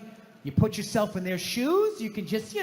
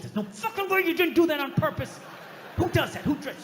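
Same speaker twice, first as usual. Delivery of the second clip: higher, heated rant with a shout; fuming rant; 6 semitones higher than usual. Retold or imitated. Walker still fuming days later, ranting about the stranger. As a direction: vent at the stranger who walked on.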